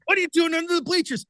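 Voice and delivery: high, fast, baffled question; puzzled question. Retold or imitated. Jason puzzled by his hiding.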